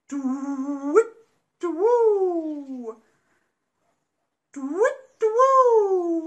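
loud hooting owl calls; owl hoots. Acted. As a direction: hoot like an owl at night. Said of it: "went the owls".

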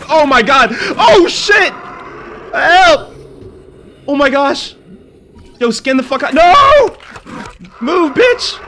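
real terror, high loud screams; panicked screaming. Real, not acted. Player jumped by a creature in the cave, screaming in panic. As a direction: scream and shout for your life.

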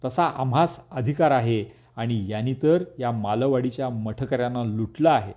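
plain reading voice

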